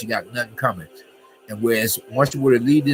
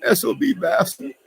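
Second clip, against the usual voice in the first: mock tearful; 10 semitones higher, 3 dB louder than usual. Feigning sympathy for abuse victims, mock tearful. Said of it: "It makes me wanna cry".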